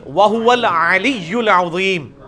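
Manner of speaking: وَهُوَ الْعَلِيُّ الْعَظِيمُ recited with throaty ain; Quranic recitation. Shown as a demonstration. Teacher reciting a verse to model the ain. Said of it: "آئین".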